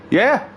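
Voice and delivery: "Yeah?" squeaked up very high; clueless questioning yeah. Retold or imitated. Friend still not hearing a word, very high clueless "Yeah?". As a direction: exaggerate the oblivious "Yeah?" with a high rise. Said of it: "And the way he says".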